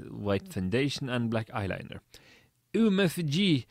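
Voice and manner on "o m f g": high, excited gushing; hammed-up excitement, pitched up. A narrator's reading. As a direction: gossip excitedly to a friend. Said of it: "she said excitedly".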